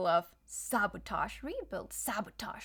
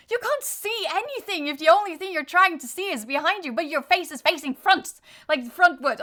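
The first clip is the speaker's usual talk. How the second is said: loud, higher, exasperated rant; frustrated; 6 semitones higher, 10 dB louder than usual. Real, not acted. Reader scolding the collective, loud and exasperated. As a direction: let real frustration push the voice up and out. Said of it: "I'm very frustrated".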